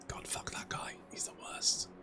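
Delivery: hushed whisper of contempt; whispered curse. Real, not acted. Cursing rival Vashnar under his breath, whispering. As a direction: whisper it like a private grudge.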